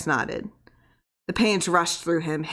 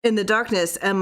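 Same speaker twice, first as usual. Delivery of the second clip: ordinary delivery, nothing marked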